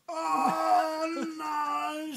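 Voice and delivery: high fed-up wail; exasperated dad. Acted. Sleepless dad at his wits' end, high wailing cry. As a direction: wail it like a worn-out father. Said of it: "said Dad".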